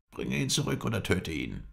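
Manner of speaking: high-pitched order; commanding. A narrator's reading. Father orders his son to catch the escapee, commanding. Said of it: "gebot Mohammed Emin seinen Sohne".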